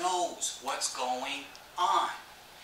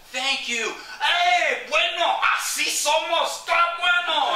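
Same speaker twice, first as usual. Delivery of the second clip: high, shouted Spanish cheering; exuberant, pitched-up holler; loud celebration; 14 semitones higher, 10 dB louder, slower than usual. Retold or imitated. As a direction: whoop it up with fellow finishers. Celebrates with the family.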